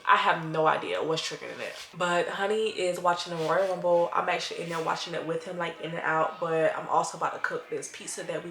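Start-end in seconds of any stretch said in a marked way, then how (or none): none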